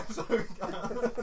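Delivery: slow, laughing repeat; laughing while repeating the joke. Real, not acted. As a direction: giggle through the words.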